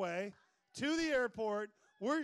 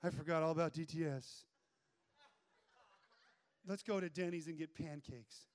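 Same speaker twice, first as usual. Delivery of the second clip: instant backslider; 6 semitones lower than usual. Acted. Student giving in to an old flame, low and mock casual. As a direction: drop low into a mock easygoing cave-in. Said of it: "I'm instantly like".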